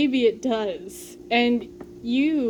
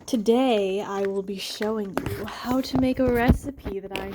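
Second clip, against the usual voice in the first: breathy voice. Pretend. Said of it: "talking in that weird, like, breathy voice".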